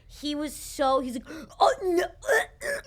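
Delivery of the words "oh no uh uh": high, slow flustered whimpers; panicked flustered noises. Retold or imitated. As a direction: stammer like you're caught out. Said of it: "there were so many noises".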